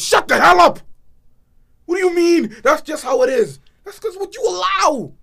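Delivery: furious outburst, high and loud; angry shout. Real, not acted. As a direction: yell it in outrage. Ranting at complacent elders.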